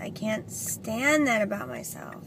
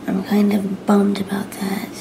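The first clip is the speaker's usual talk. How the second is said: whispering; 7 dB louder than usual. Real, not acted. Whispers to camera throughout.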